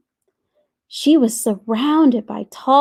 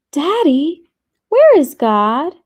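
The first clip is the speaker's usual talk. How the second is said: higher-pitched little questioner; lightly voiced child, pitched up and curious; child's question; 6 semitones higher, 5 dB louder than usual. A narrator's reading. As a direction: ask Daddy, voice lifted high. Child asks her father.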